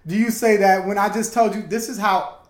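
loud, high-pitched protest; indignant outburst. Real, not acted. Arguing back at a friend who misread him, indignant.

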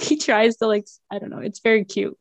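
normal talking voice

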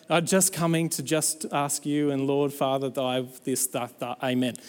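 low, trailing filler-word prayer; mock rote prayer. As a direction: mumble through a padded prayer, subdued. Parody of wordy prayer.

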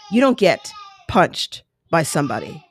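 ordinary talk

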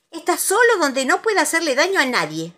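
high, loud cross answer; indignant. A narrator's reading. Conejo to Piglet about Tiger, indignant. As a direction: answer huffily.

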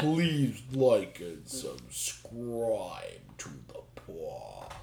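very slow, dragged-out words; slow motion. Pretend. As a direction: stretch every word way out. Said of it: "You're gonna just speak in slow motion".

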